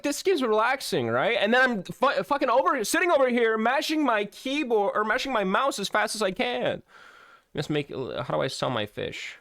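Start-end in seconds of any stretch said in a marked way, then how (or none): 0.0-1.4 mock cheerful. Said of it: "I was like". 1.4-6.8 frantic excited ranting. Real, not acted. Frantic mashing complaint.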